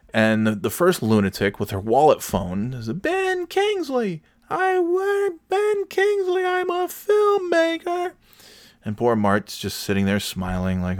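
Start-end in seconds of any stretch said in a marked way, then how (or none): 2.9-8.1 very high, drawn-out mock voice. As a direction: mimic her in a piercingly high, slow caricature. Acting out the first lunatic.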